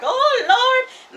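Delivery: high, loud laughing yelp of fright; laughing shriek of panic from the back seat; laughing panicked cry. Retold or imitated. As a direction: cry out half laughing, half scared on a wild ride. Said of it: "and I was like".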